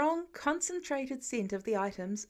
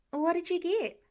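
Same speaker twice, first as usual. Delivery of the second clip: higher, eager question; eager Peter; 7 semitones higher, 3 dB louder than usual. A narrator's reading. Peter asking Pepper about her bag, higher and eager. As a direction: pipe up keenly.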